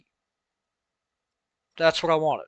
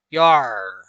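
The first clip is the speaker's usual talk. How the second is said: gruff pirate growl; 4 semitones lower, 7 dB louder than usual. Pretend. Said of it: "like a pirate".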